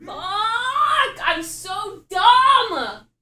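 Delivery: loud, high self-scolding shout; frustrated yelling. Real, not acted. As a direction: yell at yourself in frustration.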